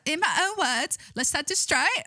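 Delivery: high-pitched, hammed-up Scottish; attempted Scottish accent. Shown as a demonstration. Streamer's last try at the statement in a Scottish accent. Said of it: "I can't do it".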